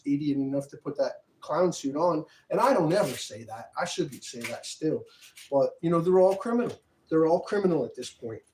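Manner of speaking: loud, heated complaint; a real angry rant. Real, not acted.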